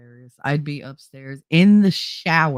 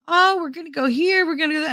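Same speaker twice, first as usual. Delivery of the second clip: mock chirpy relative; 11 semitones higher, faster than usual. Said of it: "used my kids".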